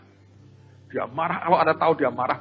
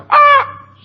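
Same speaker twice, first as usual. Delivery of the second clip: sharp high loud "Ah!" of a bossy wife; sudden high, loud imitated outburst; bossy yell; 11 dB louder than usual. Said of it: "Kalau si istri mulai".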